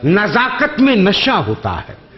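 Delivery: measured, lingering verse delivery; poetry recitation. Acted. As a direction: recite each line slowly for effect. Mushaira couplet recital.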